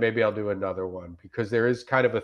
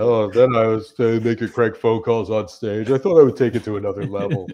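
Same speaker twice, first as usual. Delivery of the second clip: mock smug reviewer. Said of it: "mock Yelp reviewer voice".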